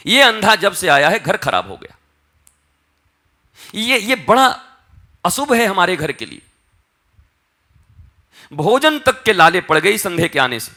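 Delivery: loud, high-pitched blaming; scolding. Retold or imitated. Family turning on the blind boy, loud scolding. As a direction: play relatives railing against the child. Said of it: "जब मारने डांटने लगे".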